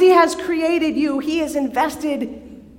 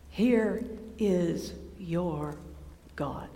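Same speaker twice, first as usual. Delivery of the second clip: slow, much softer and lower, reverent; hushed awe; 7 semitones lower, 12 dB quieter, much slower than usual. Acted. Preacher revealing God to listeners, in a slow hush. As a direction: let it fall to a reverent hush. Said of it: "slow hushed proclamation".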